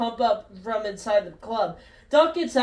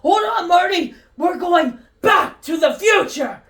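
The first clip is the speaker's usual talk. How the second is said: shouting; 7 semitones higher, 5 dB louder than usual. Acted. Doc shouting while escaping.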